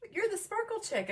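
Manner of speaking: high, squealy excited fan voice; "You're" squealed up high as the fan recognizes her; excited stranger. Retold or imitated. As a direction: imitate a thrilled stranger spotting a star. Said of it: "she looks over at me and she says".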